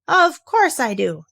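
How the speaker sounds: loud, slow and affronted; indignant. A narrator's reading. Owl calling back to Kanga, indignant and loud. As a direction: call back offended and loud. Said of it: "calling back indignantly".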